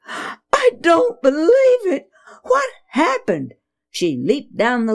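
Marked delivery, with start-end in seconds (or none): 0.1-3.5 much higher, half-crying disbelief from mother; shocked gasp. Acted. Mother finding the broken idol at home, shocked and tearful. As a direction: gasp it out in shock, near tears. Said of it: "she gasped".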